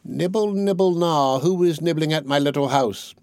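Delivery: high, otherworldly voice calling from inside the house; sweetly eerie high call from the parlour; soft witch voice. A narrator's reading. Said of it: "a soft voice cried from the parlour".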